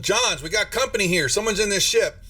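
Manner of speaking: alarmed woman. Acted. GM voices alarmed woman calling.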